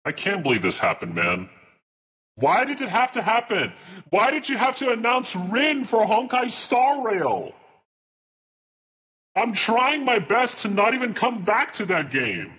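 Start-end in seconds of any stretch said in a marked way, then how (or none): 2.3-12.5 much higher, hammed-up anguished whine; exaggerated wail. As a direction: wail it like a betrayed fan. Streamer wailing over Rin announcement.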